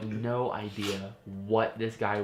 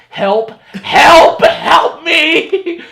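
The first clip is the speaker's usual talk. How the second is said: high, loud, laughing mock scream; hammed-up cry for help through laughter; 18 dB louder, much slower than usual. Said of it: "I was like".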